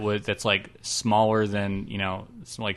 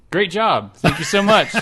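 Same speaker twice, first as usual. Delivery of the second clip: loud, higher and laughing through it; enthusiastic; 7 semitones higher, 6 dB louder than usual. Shown as a demonstration. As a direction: gush the thanks, over the top. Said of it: "Take two".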